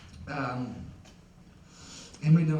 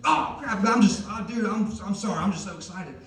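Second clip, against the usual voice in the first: hyper dad apology; 6 semitones higher, 4 dB louder than usual. Retold or imitated. Dad caught in kitchen, apologizing.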